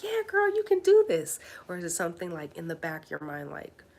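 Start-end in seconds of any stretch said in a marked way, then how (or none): 0.0-1.4 high, loud peppy cheer; cheering inner voice. Acted. Acts out encouraging inner voice.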